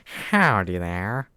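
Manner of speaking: loud, slow, drawn-out Southern US "Howdy", rising at the end; exaggerated southern accent. Shown as a demonstration. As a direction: ham up a cowboy southern drawl, stretching every word. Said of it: "I guess I could say stuff like".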